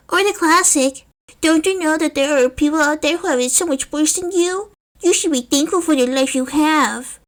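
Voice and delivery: a kid mimicking a lecture; young boy voice. Narrator voices young Izuku.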